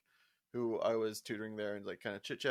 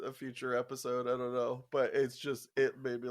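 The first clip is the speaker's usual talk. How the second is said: ordinary talk, nothing marked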